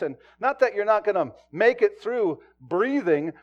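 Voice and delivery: loud, high, driving pulpit voice; full-force preaching. Acted. Preacher to congregation about chaos, in a loud, ringing voice. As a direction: build the sermon to a ringing climax.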